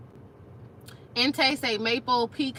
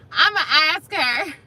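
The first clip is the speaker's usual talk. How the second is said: ordinary talk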